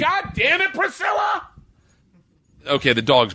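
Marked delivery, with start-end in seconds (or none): 0.0-1.5 high exasperated call; yelling at the dog. Real, not acted. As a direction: shout after the dog.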